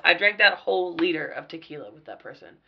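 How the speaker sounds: ordinary delivery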